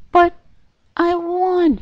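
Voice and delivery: put-on a dying child's disbelief; high squeaky voice fading in shock; wounded Pinkie. A narrator's reading. Pinkie stabbed.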